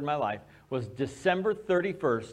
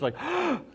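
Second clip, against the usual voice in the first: imitated gasp; 12 semitones higher than usual. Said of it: "this audible gasp".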